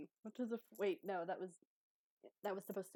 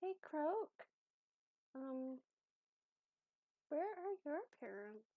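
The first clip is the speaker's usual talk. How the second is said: higher-pitched, hesitant character voice; Abby's careful question; 8 semitones higher, slower than usual. Acted. Abby asks Croak about the parents, high and hesitant. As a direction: ask gently in a high voice, with long pauses. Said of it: "and she's just like".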